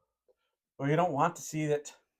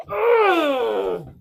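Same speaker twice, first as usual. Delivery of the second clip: high, loud wail of "ugh"; loud disgusted groan; 11 semitones higher, 7 dB louder than usual. Real, not acted. Reacting to what was shown, loud groan. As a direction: groan it out loudly, falling in pitch.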